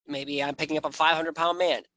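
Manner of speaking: ordinary talk, nothing marked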